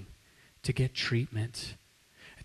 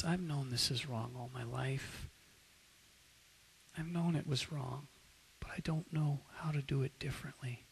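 weeping; 9 dB quieter than usual. Retold or imitated. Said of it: "begins to weep bitterly".

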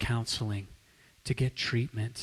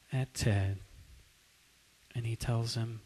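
normal talking voice

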